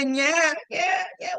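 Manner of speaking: high-pitched mock infant wail; imitated baby cry. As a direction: wail like a baby calling for mother.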